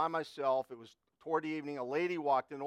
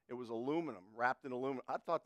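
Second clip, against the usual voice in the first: ordinary delivery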